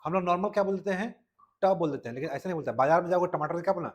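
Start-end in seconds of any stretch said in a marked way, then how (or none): none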